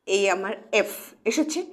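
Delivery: usual speaking voice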